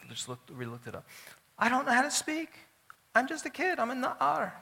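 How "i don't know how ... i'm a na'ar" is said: put-on very high-pitched kid voice; Jeremiah. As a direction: protest like a child who isn't up to it. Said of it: "Yirmiyahu said".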